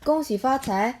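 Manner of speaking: usual speaking voice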